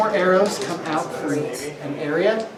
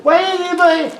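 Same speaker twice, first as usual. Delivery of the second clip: high pixie voice; squeaky sprite asking; pixie whine; 13 semitones higher, 3 dB louder, faster than usual. Acted. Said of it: "a little voice".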